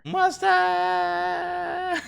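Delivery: high squealing burst of delight; excited shriek. Real, not acted. When chat suggests mustard, a high excited shriek. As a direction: shriek the word like it thrills you.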